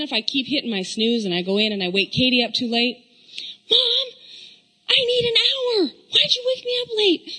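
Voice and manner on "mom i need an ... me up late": high, whining daughter voice near tears; whiny teen daughter. Retold or imitated. Mom imitating her daughter whining about being woken late. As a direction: whine like a sleepy teen girl.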